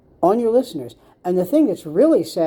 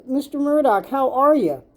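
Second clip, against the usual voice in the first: mock greeting; 7 semitones higher than usual. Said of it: "gone up to him and said".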